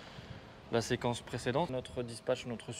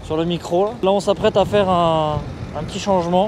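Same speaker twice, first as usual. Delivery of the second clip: raised voice against gusts; shouting over wind; 4 semitones higher, 5 dB louder than usual. Real, not acted. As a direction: push the voice over wind noise. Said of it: "avec le vent".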